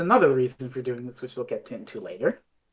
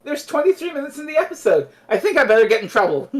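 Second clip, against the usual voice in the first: high, chirpy lightly voiced Romana, laughing; mock Romana; 11 semitones higher than usual. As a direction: breezily announce the plan, way up high. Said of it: "Romana decides".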